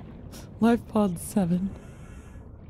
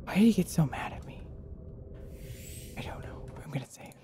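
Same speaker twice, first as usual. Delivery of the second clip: shaken, quick whisper; 8 dB quieter, faster than usual. Real, not acted. Rattled diver whispering after an attack. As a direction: whisper like it might hear you.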